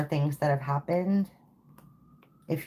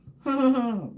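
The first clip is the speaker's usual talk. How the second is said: bright laugh; 9 semitones higher than usual. Real, not acted. Laughing at viewer's comment.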